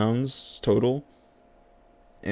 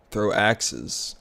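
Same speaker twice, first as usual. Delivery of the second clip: ordinary talk, nothing marked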